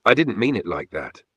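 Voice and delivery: ordinary delivery, nothing marked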